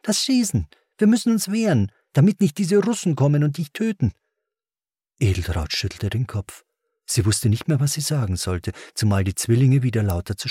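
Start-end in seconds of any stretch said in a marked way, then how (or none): 0.0-4.1 put-on high, urgent child's voice; much higher, insistent boy; protesting boy. A narrator's reading. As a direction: plead the case like an earnest kid. Boy argues back at mother.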